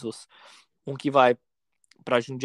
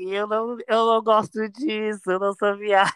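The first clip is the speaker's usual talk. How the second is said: mock crying; 7 semitones higher than usual. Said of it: "Comecei a chorar".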